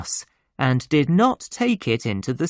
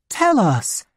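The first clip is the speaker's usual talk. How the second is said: higher-pitched, insistent order; urgent demand; 7 semitones higher than usual. Acted. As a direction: press the child to tell. Older sister demands the answer.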